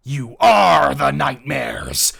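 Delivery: slow, very loud monster bellow; monstrous accusation. Acted. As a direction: stretch it out in a menacing roar. Mom turns into nightmare monster.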